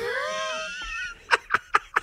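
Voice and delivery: very high, squealing laugh; helpless laughter. Real, not acted. Co-host cracks up at the Canadian bit, squealing laughter. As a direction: crack up uncontrollably.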